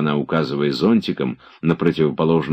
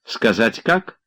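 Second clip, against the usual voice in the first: teasing, higher and playful; sly teasing; 7 semitones higher, slower than usual. A narrator's reading. Girl slyly teasing Oblomov on the river. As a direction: tease him slyly. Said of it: "лукаво дразнила она".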